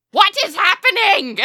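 high, very loud anguished scream. Acted. Matron mother demanding answers of the sky, screaming. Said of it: "screaming to the sky".

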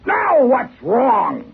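hammed-up impatient passenger yelling; mock roar. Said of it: "the passenger roared".

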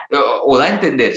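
usual speaking voice

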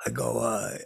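normal talking voice

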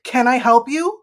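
cold Northeast "can I help you"; curt challenge. Retold or imitated. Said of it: "Legit just like that".

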